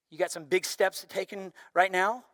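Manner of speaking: usual speaking voice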